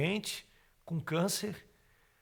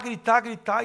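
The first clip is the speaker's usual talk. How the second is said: ordinary talk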